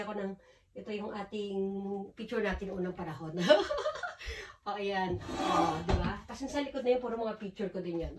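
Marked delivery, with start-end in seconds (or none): 3.4-4.6 high, loud giggle; laugh. Real, not acted. Laughs at own old pictures.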